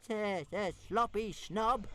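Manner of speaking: high, slow stammering jeer; calling out taunt. Acted. Toothless calling a stuttered insult at Fireworm, high and slow. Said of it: "Toothless called out".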